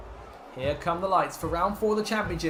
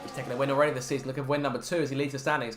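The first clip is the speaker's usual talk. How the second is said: normal talking voice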